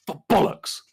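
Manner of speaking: high British BOLLOCKS; mock British cursing on BOLLOCKS; British accent swearing. Shown as a demonstration. Brit demonstrates swear words for American, British accent swearing. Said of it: "British accents shouting British swear words".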